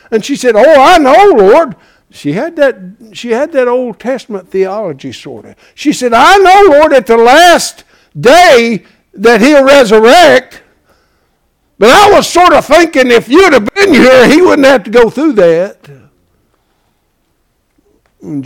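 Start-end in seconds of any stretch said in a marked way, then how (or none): 0.1-5.1 high, loud preacher's delivery; full-force preaching. Acted. 5.7-15.9 high, very loud pulpit voice; full-force preaching. Acted.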